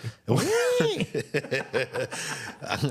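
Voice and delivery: a long real laugh that breaks out; hearty laugh. Real, not acted. Friend laughing hard at a podcast host's story.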